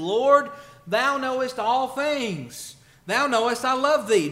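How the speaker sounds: slow, loud, high, anguished protest; grieved cry. Acted. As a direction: drag out the hurt answer. Peter's third grieved answer.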